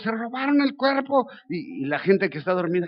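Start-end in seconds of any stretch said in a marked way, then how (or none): 0.0-1.3 alarmed shout. Retold or imitated. Others calling out through the streets in alarm. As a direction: cry it out in alarm. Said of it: "y está gritando".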